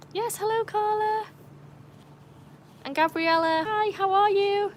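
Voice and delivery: very high, singsong hello; excited greeting. Real, not acted. Streamer greets regulars in chat, high excited hellos. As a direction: pitch the welcome way up with delight.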